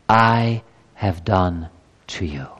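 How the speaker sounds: "I have done to you" drawn out and falling; slow emphatic close of the verse; slow, weighted phrase. Real, not acted. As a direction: let each word land slowly. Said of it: "what is most important is not what you do".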